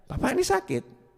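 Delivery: high, questioning visitor's voice; puzzled visitor. Retold or imitated. Visitor puzzled why the sick man smiles, high querying voice.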